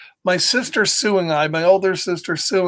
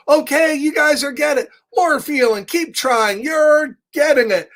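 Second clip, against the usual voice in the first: encouraging Gerald; 8 semitones higher than usual. Acted. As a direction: urge the squirrels on like a proud teacher. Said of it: "Gerald's like".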